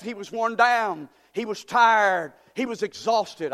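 usual speaking voice

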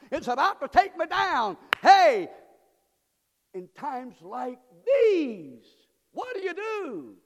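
high, loud, slow preacher's cry; full-force preaching. Acted. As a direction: call it out slow and loud to the congregation.

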